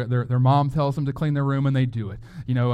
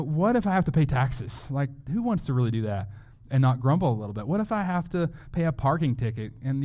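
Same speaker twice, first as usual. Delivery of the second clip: usual speaking voice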